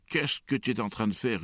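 put-on outraged uncle catching a child out; angry roar. A narrator's reading. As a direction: roar the question at the culprit. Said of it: "rugit oncle Vania".

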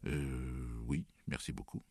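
low, hesitant thanks; mumbled. A narrator's reading. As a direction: mumble it awkwardly after an "euh". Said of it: "bredouillai-je".

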